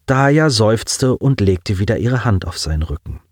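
plain reading voice

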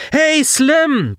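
high, loud, cutting in; bright loud outburst. A narrator's reading. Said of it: "platzte Collins Stimme hell und laut dazwischen". (hell und laut dazwischenplatzen)

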